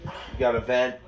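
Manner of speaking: ordinary delivery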